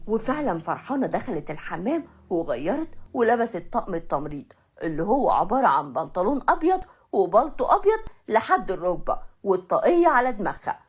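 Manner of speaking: plain reading voice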